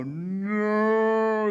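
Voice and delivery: very drawn-out, high, loud "no"; slow-motion scream. Retold or imitated. Soldier seeing the can too late, mock slow-motion scream. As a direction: hold the cry as in slow motion. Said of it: "like slow-mo".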